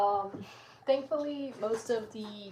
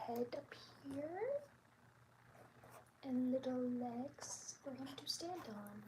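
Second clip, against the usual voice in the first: whispering; 4 semitones higher, 10 dB quieter than usual. Real, not acted. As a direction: breathe the words out quietly. Whispers while drawing.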